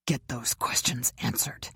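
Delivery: harsh whisper. A narrator's reading. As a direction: hiss the order under your breath. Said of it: "he said harshly".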